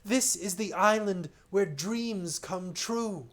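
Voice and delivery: stranger voice. Acted. Stranger's warning in story.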